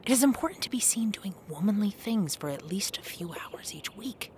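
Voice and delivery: hushed conspiratorial whisper. Acted. Woman whispering to her fellow accused witch. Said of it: "What are we whispering?".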